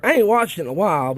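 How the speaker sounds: normal talking voice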